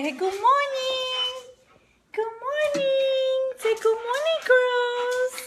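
high, sing-song doggy talk; pet baby talk. Pretend. Owner coaxes her dog to greet the girls, high pet baby talk.

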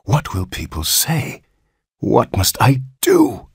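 subdued dismay, rising at the end; horrified murmur. A narrator's reading. Father dreads what people will say, horrified. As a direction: fret aloud, aghast, stretching the last question. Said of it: "he murmured in an ecstasy of horror".

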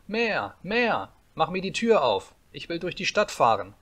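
put-on high, insistent little-boy demands; demanding cry. A narrator's reading. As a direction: call it out like a bossy child. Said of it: "schrie Häwelmann". (forderndes Kindergeschrei)

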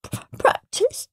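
high, breathless sniffling question; sniffly whisper. Acted. Peter sniffling after crying, hopeful. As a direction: sniff it out, still tearful. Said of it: "sniffed Peter".

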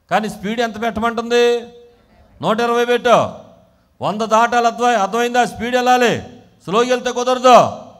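loud, high, insistent preaching; preaching at full force. Real, not acted. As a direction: urge the congregation on forcefully.

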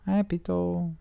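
high cooing hello to Pito; pet talk. Real, not acted. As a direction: lift into a high coo for the cat. Greeting cat Pito.

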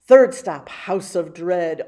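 ordinary delivery, nothing marked

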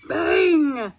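ordinary delivery

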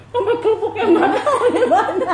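laughing. Real, not acted. Laughing about the spicy food.

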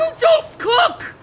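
high, slow, yelled in disbelief; shocked shout. Real, not acted. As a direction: yell it out in shock. Shocked at robot cooking.

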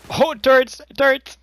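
loud, high warning yell; alarmed shout. Real, not acted. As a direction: scream the warning to the team. Spotting turrets in a raid.

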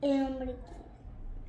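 whispering. Acted. Whispered spooky name.

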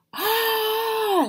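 high piercing scream of fright. Acted. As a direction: shriek at the spider. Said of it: "Sydney screamed".

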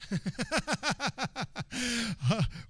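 soft, rapid chuckle; long quiet run of giggles; laughing. Real, not acted. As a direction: chuckle at your own joke. Laughs at his own tax joke.

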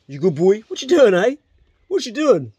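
high, loud doting coo; doting dog talk. Real, not acted. Owner fusses over his old dog, in a high doting coo. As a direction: fuss over the dog like a pup.

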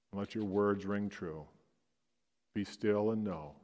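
soft, hushed meditation voice; guided prayer meditation. Acted. Pastor guiding the congregation into silent prayer, hushed and soft. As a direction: lead them gently into stillness.

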